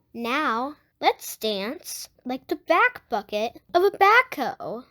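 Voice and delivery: lively picture-book read-aloud; reading to children. Acted. Host reading a Blippi book to young viewers, lively read-aloud. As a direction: read it out to little kids, bright and clear.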